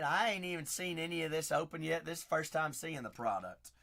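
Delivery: ordinary talk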